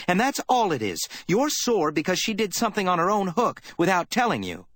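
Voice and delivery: put-on a worried girl lashing out in contempt; young secretary, furious. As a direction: tear into him for doing nothing. Effie voiced, accusing Spade.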